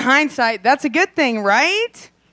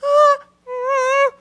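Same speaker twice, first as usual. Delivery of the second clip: trapped coyote whimper; 14 semitones higher, 4 dB louder than usual. Retold or imitated. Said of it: "like a coyote in a trap".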